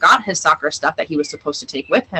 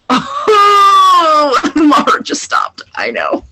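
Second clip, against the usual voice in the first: a choked, teary cry, much higher and louder; overwhelmed to the point of tears; emotional cry; 12 semitones higher, 4 dB louder, much slower than usual. Real, not acted. Mom overwhelmed by daughter's words.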